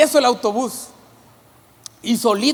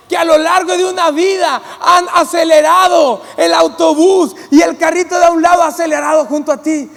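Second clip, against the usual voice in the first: loud, high, fervent preaching; preaching at full force; 3 semitones higher, 5 dB louder than usual. Acted. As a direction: drive it home to the congregation with full force. Preaching climax.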